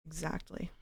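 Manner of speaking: put-on whispered Valley Girl Exactly with a rise; quiet Valley Girl echo. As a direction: softly repeat Exactly, Valley Girl style. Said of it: "I can do the Valley Girl voice".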